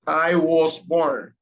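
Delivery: slowed right down, every word clear; slow model sentence. Shown as a demonstration. Teacher models the sentence slowly for the class. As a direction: lay the words out slowly. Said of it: "For example".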